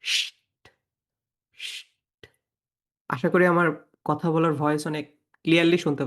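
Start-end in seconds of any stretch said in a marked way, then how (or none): 0.0-2.3 শিট as voiceless "sht", twice, paused; long hush cut off by "t" for শিট; sound demo. Shown as a demonstration. Teacher repeats cluster for class, শিট as bare "sht". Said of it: "এরকম উচ্চারণ হয়".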